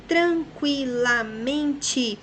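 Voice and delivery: slow, syllable-by-syllable count; separando as sílabas. Shown as a demonstration. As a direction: stretch it out beat by beat. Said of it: "nós temos um, dois, três, quatro, cinco". (tranquilamente dito sílaba por sílaba, devagar)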